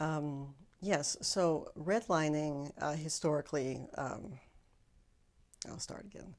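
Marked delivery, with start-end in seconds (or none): 5.7-6.3 whispered aside. Real, not acted. Restarting the answer quietly.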